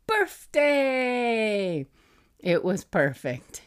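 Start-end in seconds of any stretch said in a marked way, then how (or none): 0.1-1.9 put-on high excited little-kid shout; toddler's yell. Mom imitating her toddler yelling at his birthday cake, high child voice. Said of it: "and you yelled".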